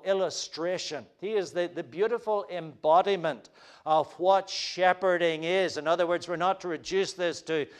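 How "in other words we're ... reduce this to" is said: usual speaking voice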